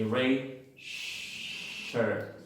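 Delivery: "sure" with a long hushed shhh before the vowel; "rate, sure" in two parts, long airy sh; voiceless sh. Shown as a demonstration. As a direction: hold an unbuzzed "shhh" at the start of "sure". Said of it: "En la treinta y siete no hay vibración".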